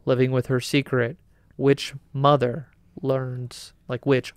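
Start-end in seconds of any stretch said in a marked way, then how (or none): none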